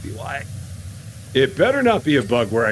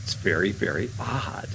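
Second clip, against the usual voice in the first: whisper; 3 semitones lower, 6 dB quieter than usual. Real, not acted. Puzzled at broken game beam.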